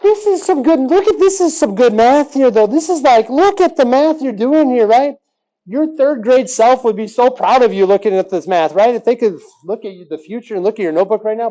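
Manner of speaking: hyped-up excitement. Acted. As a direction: gush like a proud cheerleader over the math. Hyping up students about their math.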